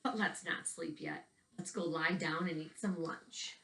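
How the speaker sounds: put-on gentle storytelling read for young listeners; picture book narration. A narrator's reading. Teacher reading a picture book to children, storytelling voice. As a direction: read the book aloud to the kids, evenly paced.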